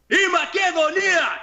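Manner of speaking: high, drawn-out rallying shout; crowd-riling yell. Retold or imitated. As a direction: rile up the crowd with a big holler. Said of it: "I'm riling everybody up".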